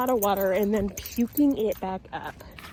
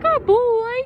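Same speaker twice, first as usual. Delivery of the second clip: happy praise; 13 semitones higher, 9 dB louder than usual. Real, not acted. Trainer praising her Frenchie, high and excited. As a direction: gush praise up high at the dog. Praising dog after command.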